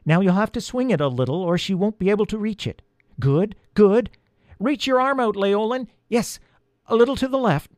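high-pitched, urgent guiding voice; rabbit lookout. A narrator's reading. Harriet the rabbit directs the rope, urgent guiding.

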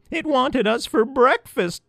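high, slow squeak; squeaky mouse. A narrator's reading. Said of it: "squeaked one mouse".

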